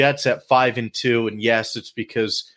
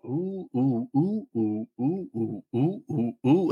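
soft hooting oohs in a row; string of oohs; 6 dB quieter, slower than usual. Pretend. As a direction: croon a run of soft oohs. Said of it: "How many oohs can I do?".

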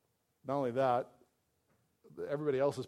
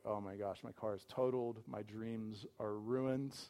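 dismayed groan; 4 semitones lower, 7 dB quieter than usual. Retold or imitated. Devastated driver after car crash.

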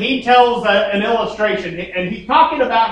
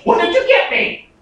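a lightly voiced, higher excited kid; eager child voice; 7 semitones higher than usual. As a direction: ask like a greedy little kid. Child greeting daddy, voiced again.

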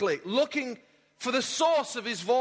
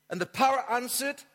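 usual speaking voice

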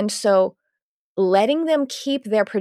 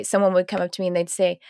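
usual speaking voice

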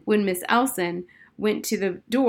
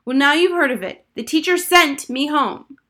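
put-on louder, higher defiant girl; snapping back; 7 semitones higher, 8 dB louder than usual. A narrator's reading. Harriet snaps back.

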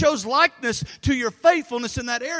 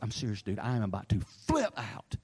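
low and much softer, tight with frustration; strained plea; 12 semitones lower, 7 dB quieter than usual. Retold or imitated. Pastor insisting to son, low strained warning. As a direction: warn him quietly you're near snapping.

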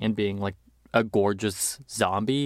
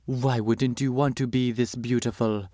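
put-on a loose stab at Isabella Rossellini's accent; terrible Isabella Rossellini accent; 2 semitones higher than usual. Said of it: "that's terrible Isabella Rossellini accent".

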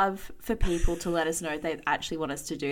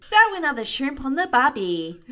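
mock Aussie cliché; 7 semitones higher, 6 dB louder than usual. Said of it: "things international people think we say".

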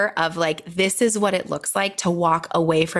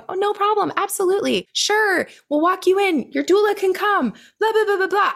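much higher, sing-song accommodating nurse; eager nurse; 11 semitones higher than usual. Retold or imitated. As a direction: chirp it like a nurse eager to please.

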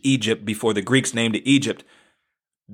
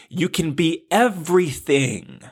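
ordinary talk, nothing marked

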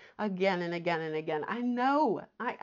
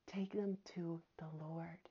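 whisper; 3 semitones lower, 12 dB quieter than usual. Real, not acted. Intimate counsel to listeners.

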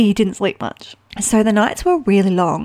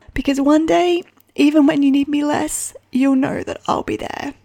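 higher, tearful voice near crying; voice breaking with emotion; 5 semitones higher than usual. Real, not acted. Mum finishing a poem for her son, voice cracking with tears. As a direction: let the tears rise through the last lines. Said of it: "you can tell that I'm emotional about this".

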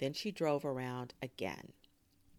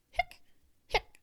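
sharp, punchy "hic"; sudden loud hiccup noise; 6 dB louder than usual. A narrator's reading. Narrator voicing the noise heard before the movie, loud hiccups. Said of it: "they heard a loud noise".

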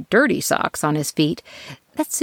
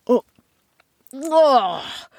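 slow, high, disgusted complaint; 7 semitones higher, slower than usual. Acted. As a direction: sound revolted by it.

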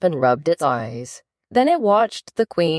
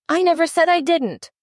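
Alice cuts in sharply, much higher; a high, quick protest of innocence; indignant interruption; 13 semitones higher than usual. Acted. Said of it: "interrupted Alice".

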